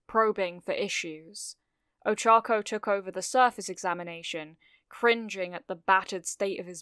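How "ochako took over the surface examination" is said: ordinary delivery, nothing marked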